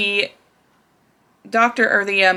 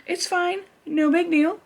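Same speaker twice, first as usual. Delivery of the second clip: high, soft, forced calm; talking herself down in a thin high voice; mock self-reassurance; 8 semitones higher, 4 dB quieter than usual. Strapped under the cage, telling herself it's fine in a high hushed voice. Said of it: "I'm like laying there".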